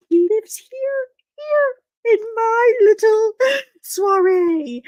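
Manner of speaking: high, slow mocking swoon; mock posh lilt. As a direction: swoon mockingly over him. Mocking the hunky guy.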